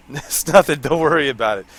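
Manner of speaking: giggling through fake reassurance; laughing innocently. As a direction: laugh while insisting nothing's wrong. Hiding TNT from teammate.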